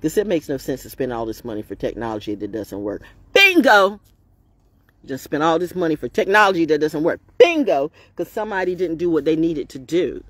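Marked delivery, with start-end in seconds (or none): 3.3-4.0 loud, high bingo; excited shout. Real, not acted. Exclaims at her own point. 7.4-7.9 loud, high bingo; excited shout. Real, not acted. Exclaims at her own point.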